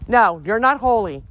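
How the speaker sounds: loud, forceful contradiction; firm rebuke. Real, not acted. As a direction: scold the spirit, loud and sure. Rebuking the spirit for its claim.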